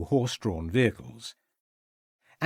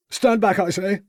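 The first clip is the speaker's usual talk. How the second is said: much higher, louder frantic order; panic, push them away; desperate youth shouting; agitated; 9 semitones higher, 5 dB louder than usual. A narrator's reading. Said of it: "said the agitated youth".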